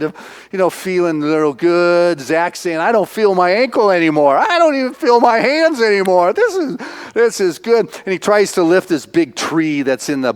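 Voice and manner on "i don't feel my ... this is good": lightly voiced tipsy boy, a bit higher; tipsy kid. Retelling Zach getting tipsy by the bonfire, lightly higher. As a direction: mildly play the kid feeling the moonshine.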